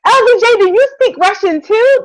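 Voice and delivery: giddy delighted question; excited teasing. Real, not acted. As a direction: gush at your co-host.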